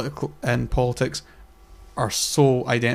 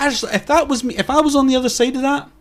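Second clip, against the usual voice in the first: ordinary talk, nothing marked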